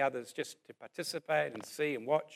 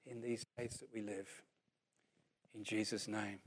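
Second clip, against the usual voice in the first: prayer; 2 semitones lower, 4 dB quieter than usual. Acted. Preacher leading the church in closing prayer, hushed. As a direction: pray over the room, gently.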